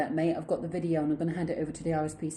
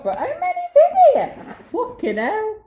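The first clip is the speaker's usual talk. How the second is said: loud, high squeal of disbelief; high-pitched excited outburst; amazed; 10 dB louder, slower than usual. Real, not acted. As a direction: cry out in real astonishment. Amazed at contents of the box.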